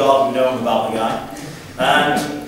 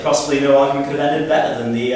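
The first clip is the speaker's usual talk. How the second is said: ordinary talk, nothing marked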